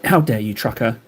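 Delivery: ordinary delivery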